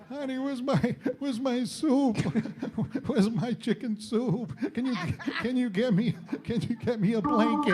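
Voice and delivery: high, pleading sick-man whine; milk it like a needy patient; whiny sick husband. Retold or imitated. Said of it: "I sometimes get the waited on hand and foot fever".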